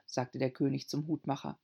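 usual speaking voice

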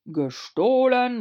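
slow, loud royal exclamation; exclaiming. A narrator's reading. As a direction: cry it out like a king. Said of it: "rief der König aus".

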